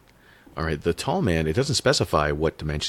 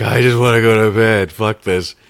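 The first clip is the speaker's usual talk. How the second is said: mock sleepy grumble; 6 semitones higher, 11 dB louder than usual. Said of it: "You're just like".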